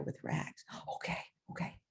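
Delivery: hushed whisper to keep the secret. Retold or imitated. Teacher tells the boy to phone his mother secretly, whispering. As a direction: whisper it so the office won't hear. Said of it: "talk as low as you can".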